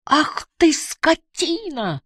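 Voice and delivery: very high, drawn-out cry; outraged cry. A narrator's reading. Barbara sees the girl thrown overboard, high horrified cry. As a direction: cry out high, stretching the curse. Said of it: "закричала Барбара".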